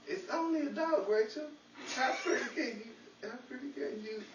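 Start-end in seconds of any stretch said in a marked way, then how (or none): none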